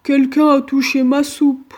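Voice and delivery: booming papa bear outcry; cri du grand ours. A narrator's reading. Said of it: "s'écria". (cri grave et fort du grand ours)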